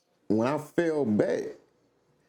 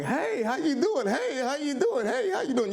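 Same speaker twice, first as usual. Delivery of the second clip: high sing-song hey how you doing; overly friendly greeting; 13 semitones higher than usual. Retold or imitated. Imitating friendly shoppers in Watson, high and cheerful. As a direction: greet every stranger brightly, much higher. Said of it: "just be like".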